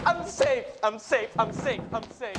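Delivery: high repeated phrase, fading away; giddy relief. Acted. Student reassuring himself after a near miss, relieved. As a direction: repeat it breathlessly, trailing to softness.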